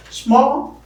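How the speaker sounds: teacher isolating the stressed syllable; isolated stressed syllable. Shown as a demonstration. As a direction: punch out the syllable Small. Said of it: "that is stressed".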